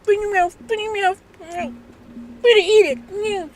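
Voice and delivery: high, soft coaxing mother; coaxing mom. Retold or imitated. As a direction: sweet-talk the toddler into a bite. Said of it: "your mom's like".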